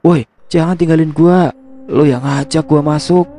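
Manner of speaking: high-pitched, mildly shouted protest. A narrator's reading. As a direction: call after the friend deserting you. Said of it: "teriak Rian". (seruan protes bernada tinggi)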